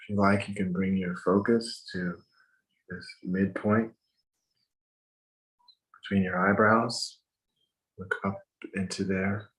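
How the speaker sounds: calm, measured breathing instructions; walk them through each breath gently; guided meditation. Acted.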